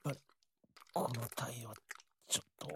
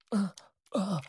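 short high moans, louder; aroused moans; 6 semitones higher, 8 dB louder than usual. Acted. Lover moaning during sex, short loud moans. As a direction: let out two sharp aroused gasps. Aroused moan mid-act.

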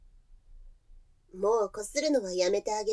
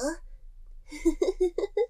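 gloating giggle; 7 semitones higher than usual. Acted. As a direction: giggle with relish. Gloating over long day. (楽しげにくすくす笑う)

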